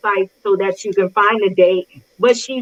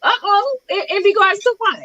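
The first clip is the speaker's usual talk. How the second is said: mock alarm; 9 semitones higher than usual. Real, not acted. Mock alarm at jumping ship.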